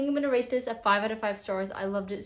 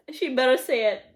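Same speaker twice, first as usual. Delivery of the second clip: eager demand; 4 semitones higher, 8 dB louder than usual. Real, not acted. Urging a character in book.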